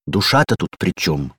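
ordinary delivery

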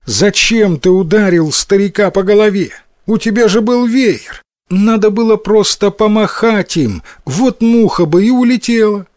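high-pitched scolding mother; exclaiming mother. A narrator's reading. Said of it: "воскликнула мать".

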